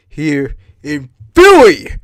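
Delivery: slow, loud proclamation peaking on "Philly"; bombastic, drawn-out boast; mock-patriotic shout. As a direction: belt out the city's name with hammed-up pride.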